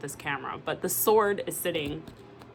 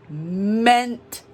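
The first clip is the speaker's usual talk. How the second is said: louder, rising declaration; loud, heartfelt emphasis on meant to; emphatic; 2 semitones higher, 7 dB louder than usual. Retold or imitated. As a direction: belt out the promise. Client voiced declaring love.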